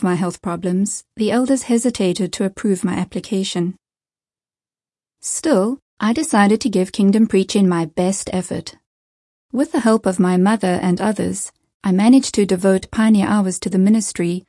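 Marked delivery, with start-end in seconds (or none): none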